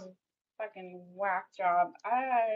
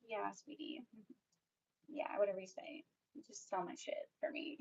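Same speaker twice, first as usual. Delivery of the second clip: whispered mock of a patronizing man; condescending whisper mock; 11 dB quieter than usual. Mocking Jeffrey humoring her, whispered. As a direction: whisper it like he's humoring a pet.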